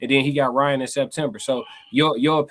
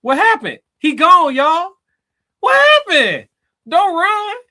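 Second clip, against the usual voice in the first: mocking shout; 7 dB louder, slower than usual. Real, not acted. Host mocks guest who left call.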